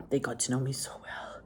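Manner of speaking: soft whisper; hushed aside. Real, not acted. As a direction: murmur it fondly, hushed.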